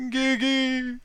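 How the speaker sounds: high, sustained slow-mo call; drawn-out dramatic name call in slow motion; slow-motion cry. Acted. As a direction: hold each vowel long and high. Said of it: "Why the slow-mo?".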